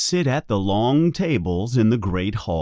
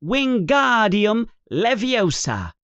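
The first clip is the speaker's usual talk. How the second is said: put-on higher, precise girl voice; Hermione casting the spell; 10 semitones higher than usual. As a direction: say the spell crisply and correctly. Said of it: "and says".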